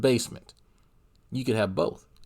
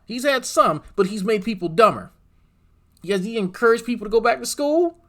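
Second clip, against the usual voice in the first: high-pitched, insistent questioning; indignant rant; 10 semitones higher, 3 dB louder than usual. Real, not acted. Streamer ranting at what the hosts achieved, indignant. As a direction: rant high and exasperated, hammering each point.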